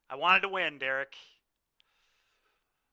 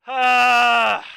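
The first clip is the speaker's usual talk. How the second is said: loud, high shout of frustration; real exasperated yell; frustrated shout; 8 semitones higher, 18 dB louder than usual. Real, not acted. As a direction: let out a sharp angry cry. Frustrated at losing.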